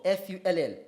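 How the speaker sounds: ordinary talk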